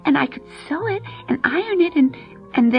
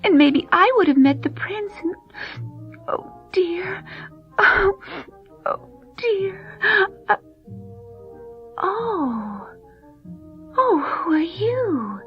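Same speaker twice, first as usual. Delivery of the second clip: put-on tearful girl; 8 semitones higher than usual. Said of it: "she felt so bad".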